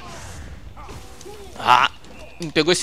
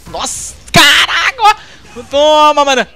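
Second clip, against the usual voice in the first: much higher, louder, slow amazed cry; amazed excitement; 10 semitones higher, 9 dB louder, slower than usual. Real, not acted. Player amazed by his own combo, high excited cry. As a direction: marvel at the hit out loud.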